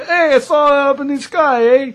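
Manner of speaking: high, loud mock Canadian with a laugh; Canadian accent. Joking about the Canada UFO sighting, high mock Canadian. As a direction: ham up a Canadian spotting lights. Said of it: "we're going to Canada".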